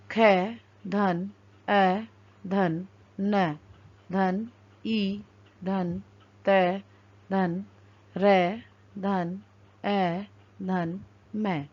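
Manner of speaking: slow letter-by-letter building of पर्णम; spelling out letters. Shown as a demonstration. Teacher spelling a word for the class, slowly. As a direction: drag out each sound as you join them. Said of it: "वर्णों का संयोजन करके".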